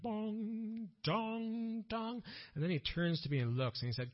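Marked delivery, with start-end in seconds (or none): 0.0-2.2 high, sustained bell tolls; imitated church bells. Hearing Lutheran church bells on a walk, imitated tolling. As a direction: sing out each toll like a ringing bell. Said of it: "the bells start ringing".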